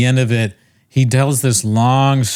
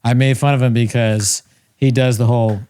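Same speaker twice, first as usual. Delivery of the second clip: ordinary talk, nothing marked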